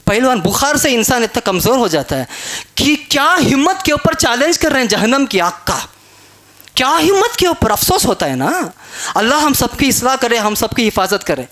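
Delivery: ordinary talk, nothing marked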